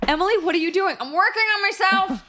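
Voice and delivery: high, loud sheepish excuse; squeaky guilty reply; mock caught-out voice.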